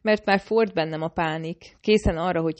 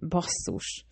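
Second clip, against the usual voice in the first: a sharp, soft hiss of a curse; hissed swear; 6 dB quieter than usual. Acted. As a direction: hiss it through the teeth, quieter. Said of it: "sziszent fel".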